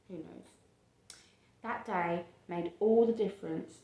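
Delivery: ordinary delivery, nothing marked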